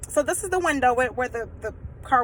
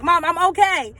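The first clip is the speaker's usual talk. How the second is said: put-on louder, higher teenage protest; acted exasperated protest; 6 semitones higher, 6 dB louder than usual. Daughter keeping mother out of room, exasperated. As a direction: fend Mom off, voice raised.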